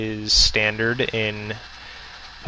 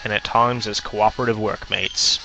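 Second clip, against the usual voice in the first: put-on Aussie professor voice; hammed-up Australian crocodile-hunter accent. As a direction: lecture like an Australian wildlife presenter. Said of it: "giving him ... an Australian accent".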